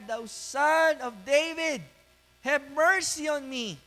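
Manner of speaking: loud, high, slow plea. Acted. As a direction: raise the cry to be heard over a crowd. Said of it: "Mas lalo niyang nilakasan".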